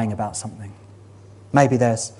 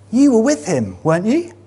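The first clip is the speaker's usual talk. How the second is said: put-on a servant girl's sharp accusation, higher and a bit louder; accusing servant girl; 9 semitones higher, 6 dB louder than usual. Servant girl accusing Peter in the courtyard, higher pitched accusation. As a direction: point at him and accuse. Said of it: "a servant girl accuses him".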